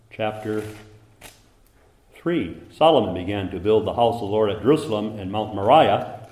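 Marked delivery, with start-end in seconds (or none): 2.7-6.3 brisk, full-voiced proclamation of the verse; scripture reading. Preacher quoting Chronicles to the congregation, brisk and strong. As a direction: read it out fast and strong.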